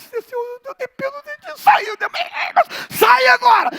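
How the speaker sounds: high prayer building to a loud command; growing into a shout. Retold or imitated. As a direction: start pleading, end shouting at the demon. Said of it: "Aí fui crescendo em grito". (de súplica a grito crescente)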